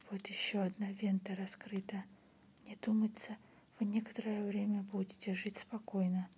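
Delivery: ordinary delivery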